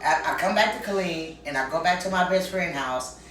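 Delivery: ordinary talk, nothing marked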